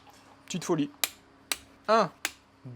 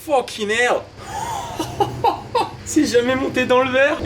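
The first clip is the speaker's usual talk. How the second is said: laughing; 9 semitones higher, 5 dB louder than usual. Real, not acted. The engine fires and he laughs in disbelief.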